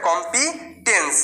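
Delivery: teacher answers her own question: কম্পিটেন্স, drawn out; slow demo pronunciation. Shown as a demonstration. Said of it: "কিরকম হবে?".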